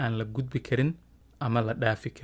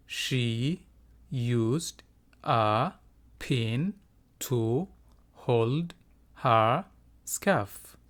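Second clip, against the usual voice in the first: each word drawn out and set apart by pauses; slow, broken-up teacher model; word by word for repeating; much slower than usual. Shown as a demonstration. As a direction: say it slowly, one word at a time, for learners. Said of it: "Iga daba dheh".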